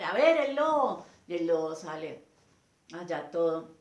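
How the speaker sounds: ordinary talk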